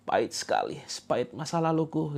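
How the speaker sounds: a touch of mock tearful lament; mock self-pity. Mocking people who cry over bitter water, mock-sad. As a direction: pretend to mope over your past. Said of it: "Jangan malah kemudian nangis".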